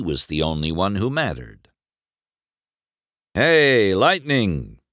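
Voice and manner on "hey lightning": loud, higher-pitched hail; calling out. A narrator's reading. Rival rolls up to the racer, calling out. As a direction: call over to a rival. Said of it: "Chick called as he rolled up".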